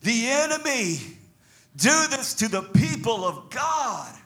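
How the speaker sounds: slow, rising cry of outrage; outraged preacher's challenge; righteous indignation. Acted. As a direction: draw out each phrase in holy indignation. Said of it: "It was a righteous indignation".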